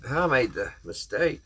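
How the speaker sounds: usual speaking voice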